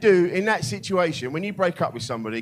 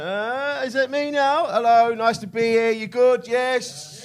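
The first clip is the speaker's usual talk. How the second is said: higher-pitched, tentative act of arriving on stage; peeking-out entrance; 7 semitones higher, 3 dB louder, slower than usual. Retold or imitated. Comedian mimics his own entrance to the crowd, high and questioning. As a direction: act out poking your head out, voice raised in pitch. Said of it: "I stick my head out like that".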